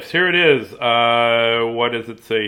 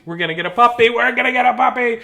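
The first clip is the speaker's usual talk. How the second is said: building excitement, rising high on the repeat; excited puppy plea; 9 semitones higher, 4 dB louder, faster than usual. Real, not acted. Husband pushing his wife for a puppy, excited. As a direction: get carried away, pitch climbing.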